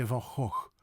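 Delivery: Van Gogh with breathy, gentle g's; light southern Dutch Gogh; southern soft G. Shown as a demonstration. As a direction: soften Gogh to "hoh". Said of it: "tend to have a soft G".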